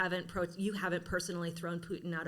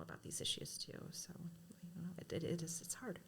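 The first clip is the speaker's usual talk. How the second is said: emotional whisper; 9 dB quieter than usual. Real, not acted. Admits getting emotional herself.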